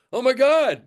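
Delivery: put-on the kid spooked by the glass-eyed bass player; horror movie fright. Retelling the scary first gig, hammed-up fright.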